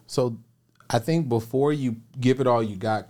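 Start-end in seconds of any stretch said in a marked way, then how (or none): none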